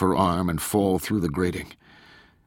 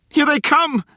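high-pitched, frightened cry of warning; fearful shrill shout; 5 dB louder than usual. A narrator's reading. Corky warning the others as he points skyward, shrill fearful shout. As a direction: shout it much higher and louder, shrill with fear. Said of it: "Corky shouted, his voice shrill with fear".

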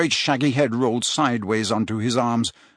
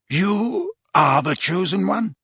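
stunned; 7 semitones higher than usual. A narrator's reading. Slughorn stunned.

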